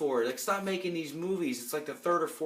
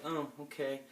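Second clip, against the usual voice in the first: quiet, unimpressed mock thanks; soft, halting, awkward acceptance, lightly imitated; underwhelmed, awkward imitation; 5 dB quieter than usual. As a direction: mimic someone for whom nothing's good enough. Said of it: "She's always like".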